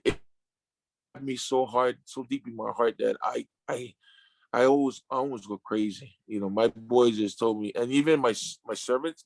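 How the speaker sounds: ordinary talk, nothing marked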